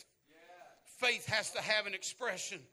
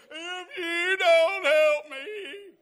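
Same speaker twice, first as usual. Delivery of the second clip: loud, drawn-out, higher desperate plea; desperate cry; 11 semitones higher, 12 dB louder, much slower than usual. Retold or imitated. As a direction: cry it out slowly as if lost without help. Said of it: "there's a desperate cry".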